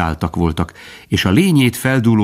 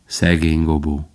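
Bambi's lower, heavier poor Gobo; low echo; 3 semitones lower than usual. A narrator's reading. Bambi echoing Faline, lower and heavier. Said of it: "Bambi ismételte".

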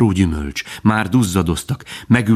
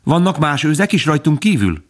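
put-on high, earnest child's question; a fawn gone serious, pitched high; serious little fawn; 8 semitones higher than usual. A narrator's reading. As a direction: ask it with a small frown. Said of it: "Bambi újra elkomolyodott".

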